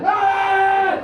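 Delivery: high, sustained mock yell; long "Ah!" like calling across a field; imitated yell. Comic mimicking someone yelling like a farmer, mock holler. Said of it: "Yelling like".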